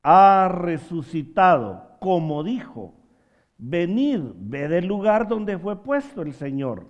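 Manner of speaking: forceful preacher quoting scripture; triumphant proclamation. Retold or imitated. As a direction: proclaim it from the pulpit.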